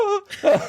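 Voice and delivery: sudden high laugh; real high laugh; high burst of laughter. Real, not acted. Laughing at the memory.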